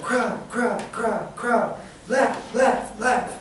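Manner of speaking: high, evenly punched repeats; repetitive drilling. Acted. Students repeating flashcard words over and over, drilling. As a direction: chant each word like a drill. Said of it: "when you're drilling, they're repetitiously saying".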